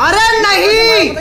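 anguished cry. Real, not acted. Teammate just got killed.

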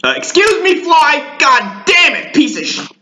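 sudden high yelp of real annoyance at a fly; yelling at a fly. Real, not acted. Vlogger shooing off an annoying fly, real high-pitched outburst.

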